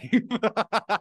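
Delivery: sudden high laugh. Real, not acted. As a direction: laugh at yourself. Laughs at own loss.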